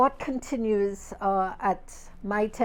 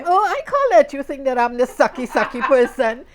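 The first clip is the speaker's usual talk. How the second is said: put-on louder, higher playful teen voice; daughter's cheeky comeback to mother; teasing daughter; 4 semitones higher, 9 dB louder than usual. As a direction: tease mom back, cheeky. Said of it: "just to be different".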